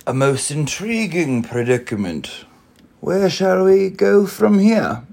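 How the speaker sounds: lightly voiced old grasshopper, rising; a curious old grasshopper's puzzled question. A narrator's reading. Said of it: "asked the grasshopper".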